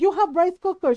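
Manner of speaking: mock scolding as if it's obvious; exasperated mom. Mom scolding daughter about rice, exasperated. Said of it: "And my mom goes".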